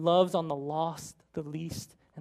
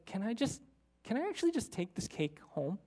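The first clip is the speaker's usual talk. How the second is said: soft, hesitant pleading; timid request; 4 semitones higher, 8 dB quieter than usual. Retold or imitated. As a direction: ask shyly and quietly for a favour. Retold tearful woman's hesitant request.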